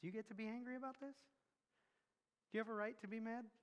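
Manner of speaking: hushed, quick voice of conscience; a soft voice inside, checking you; quiet inner voice. Retold or imitated. The Holy Spirit's quiet voice within, questioning gently. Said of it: "some voice inside you goes".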